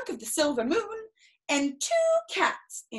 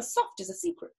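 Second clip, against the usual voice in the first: plain reading voice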